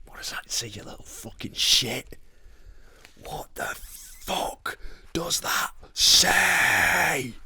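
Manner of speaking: whispered, squinting at something small. Real, not acted. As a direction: hiss it under the breath. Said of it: "reading a tiny picture".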